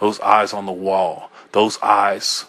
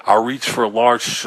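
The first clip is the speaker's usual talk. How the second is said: ordinary delivery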